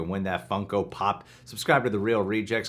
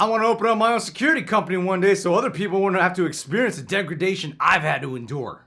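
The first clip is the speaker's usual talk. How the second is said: higher, bossy security guard; 11 semitones higher, 7 dB louder, faster than usual. Acted. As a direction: wave the line through like a bored guard. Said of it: "I'll play Alfred".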